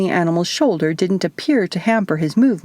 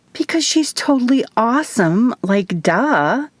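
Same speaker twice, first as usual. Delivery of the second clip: put-on high, sassy Valley-girl voice; eye-rolling teen-style "duh" delivery; 6 semitones higher than usual. A narrator's reading. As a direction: say it like it's painfully obvious, pitched up.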